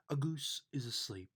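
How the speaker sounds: ordinary delivery, nothing marked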